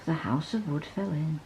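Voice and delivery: plain reading voice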